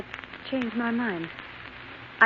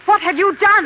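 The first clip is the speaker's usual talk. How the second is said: much higher and louder, frightened; alarmed shout; 9 semitones higher, 7 dB louder than usual. Acted. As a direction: cry it out in shock. Wife confronting husband.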